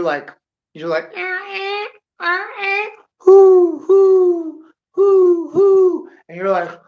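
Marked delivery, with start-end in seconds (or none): 1.1-2.9 high, steady creaking noise; imitated wooden squeak. Storyteller mimicking a Western's sound effects, high squeak. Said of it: "squeaking in the wind". 3.2-6.1 loud, high sustained hoots; imitated Western whistle hoot. Storyteller setting a spooky Western scene, loud high hooting.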